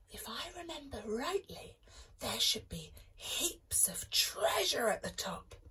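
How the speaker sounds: soft conspiratorial whisper. Acted. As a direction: keep it hushed and secret. Sneaking out, not waking mum.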